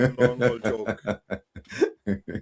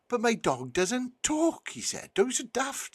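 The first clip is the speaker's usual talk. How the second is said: high-pitched scoffing disbelief; incredulous farmer; 12 semitones higher, 4 dB quieter than usual. Acted. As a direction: protest in a high, baffled voice. Farmer disbelieves dog can talk.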